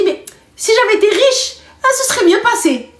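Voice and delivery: voix de Safa imitée, plus aiguë, moqueuse; imitation moqueuse de Safa. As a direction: singer la cousine qui se plaint, en aigu. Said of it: "Et elle te dit". (la cousine pleurnicharde imitée avec moquerie, voix aiguë)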